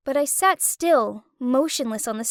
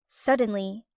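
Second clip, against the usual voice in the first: ordinary delivery, nothing marked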